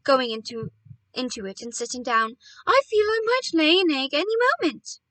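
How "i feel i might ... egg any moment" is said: high, loud, excited mother bird; excited announcement. A narrator's reading. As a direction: announce it with a high-pitched thrill. Delighted mother bird announces egg.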